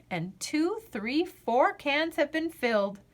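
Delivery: usual speaking voice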